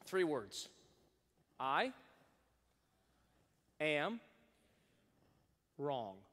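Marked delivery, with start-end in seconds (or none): 1.6-6.2 each word spaced apart; slow modeled phrase. Shown as a demonstration. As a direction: lay out each word slowly. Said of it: "practice this with me".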